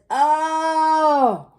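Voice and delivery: high, drawn-out mimicking "oh"; parrot echo groan. A narrator's reading. Parrot echoing the grumpy pirate, high drawn-out groan. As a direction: echo the grumble long and high. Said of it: "and the parrot says".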